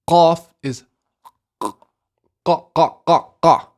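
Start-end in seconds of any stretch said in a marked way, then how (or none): 1.6-3.7 repeated QA popped from the top of the throat; short, separate QA sounds, far back; throaty qaf. Shown as a demonstration. Teacher demonstrating qaf for learners, throaty and repeated. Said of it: "here at the top of the throat".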